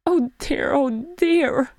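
high, distressed quaver. A narrator's reading. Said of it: "he quavered".

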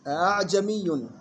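أعجمي slowly, second hamza eased toward alif; softened second hamza. Shown as a demonstration. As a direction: draw out أعجمي's softened opening. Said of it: "تصير الهمزة الثانية بين الهمزة والألف". (أعجمي ببطء مع تسهيل الهمزة الثانية)

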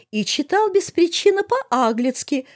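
high, piping accusation; chiming-in tattler. A narrator's reading. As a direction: chip in with a high-pitched complaint. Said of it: "вставила Полина". (высоким голосом, ябедничая)

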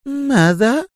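very high, astonished woman's voice; high-pitched bewildered question; stunned. A narrator's reading. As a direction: ask in amazement, pitch way up. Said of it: "وحدقت في وجهه مغمغمة".

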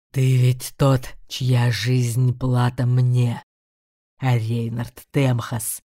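low, slow growling demon. A narrator's reading. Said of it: "прорычал демон". (низкое рычание демона)

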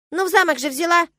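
high, loud squirrel squeak; insistent squirrel. A narrator's reading. Squirrel reminds her of the castle, squeaky insistence. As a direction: pipe up in protest.

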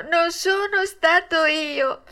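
slow wailing "non sono stato io", voice climbing; desperate wail. A narrator's reading. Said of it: "piangendo dirottamente e disperandosi". ("non sono stato io" lento e disperato, molto più acuto)

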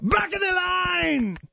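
high, slow mock holler; accented rant impression. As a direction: stretch it out in a high accented shout. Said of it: "It's the same accent".

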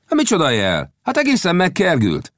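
high, indignant scoffing; indignant retort. Acted. Gibson denying he gave any signal, indignant. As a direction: scoff back in protest.

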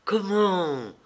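bright, high burst of delighted surprise; light up with joy at his arrival; joyful exclamation. A narrator's reading. Kitty greets her fiancé, delighted exclamation. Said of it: "s'écria-t-elle rayonnante de joie".

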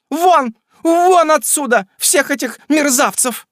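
high, loud shrill landlady berating her lodger; furious shrew tearing into the tenant; scolding landlady. Acted. Landlady Raddle scolding tirade. (сварливая хозяйка кричит на жильца)

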